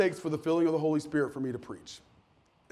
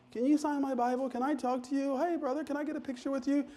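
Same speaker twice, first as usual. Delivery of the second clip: higher, softer mock-eager fan questions; eager fan voices; 10 semitones higher, 6 dB quieter than usual. Fans pestering the preacher after service, light mock eagerness. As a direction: lightly voice the crowd of well-wishers.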